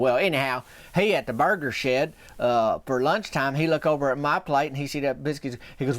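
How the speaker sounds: normal talking voice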